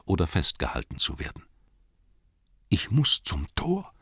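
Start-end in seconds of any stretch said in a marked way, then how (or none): 2.7-3.9 high, slow anxious hobbit voice; Bilbo muttering to himself. Acted. Bilbo to himself among the orcs, high anxious plea. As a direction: urge yourself on in panic. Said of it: "sagte er sich immerzu".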